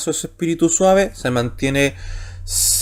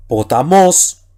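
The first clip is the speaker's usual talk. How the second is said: "potamos" with the final "mos" stressed and louder; stress on last syllable; 5 dB louder, much slower than usual. Shown as a demonstration. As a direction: lean hard on the "mos" of "potamos". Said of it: "que va en la última sílaba".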